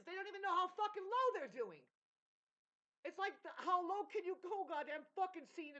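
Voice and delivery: loud, high, exasperated shouting; worked-up rant. Real, not acted. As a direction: let the frustration boil over into a shout. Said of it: "You see me going up and down".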